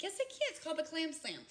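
high, loud insistence; excited retort. Retold or imitated. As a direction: proudly insist she can. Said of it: "I'm like".

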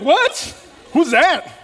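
high, loud startled "What?"; startled shout. Retold or imitated. Pastor reacting to a voice in his head, startled shout. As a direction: jump at a strange voice. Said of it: "Be like".

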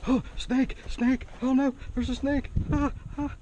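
high, loud hammed-up shrieks of panic; mock panic. Showing the camera how a panicking person scares the snake, hammed-up high panic. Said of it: "if I panicked and ran up here and started going".

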